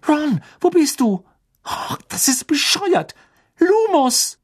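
put-on high, urgent girl's voice calling out; young witch calling in the dark, worried; anxious young witch. A narrator's reading. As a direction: search the dark for a friend.

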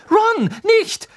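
very high, loud cry; outraged, urgent reproach; angry. A narrator's reading. Hermine stops Ron shoving her cat, angry cry. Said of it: "sagte Hermine zornig".

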